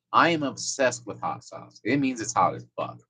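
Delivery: ordinary talk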